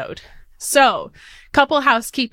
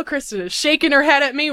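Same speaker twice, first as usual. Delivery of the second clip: usual speaking voice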